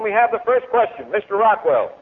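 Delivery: ordinary delivery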